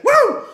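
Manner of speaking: high woo whoop; woo cheer. Real, not acted. Fan cheering, high woo. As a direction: shout a victory woo.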